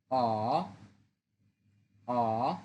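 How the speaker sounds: অ dipping low; Mandarin third tone. Shown as a demonstration. Teacher drills the third tone on অ, low dip. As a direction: dip অ down and back up. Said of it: "third tone".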